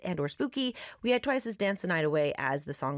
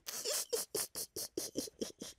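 delighted laugh; 12 dB quieter than usual. Real, not acted. Host delighted after story.

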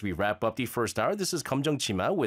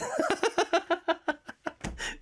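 high laugh. Real, not acted. Laughs at own accent.

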